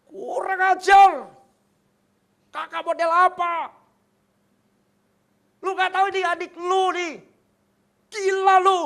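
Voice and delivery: high, loud raging yell at his brother; betrayed brother screaming abuse; furious shout. Retold or imitated. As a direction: scream the insult with full fury. Younger brother cursing the judge.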